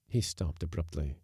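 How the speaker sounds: ordinary delivery, nothing marked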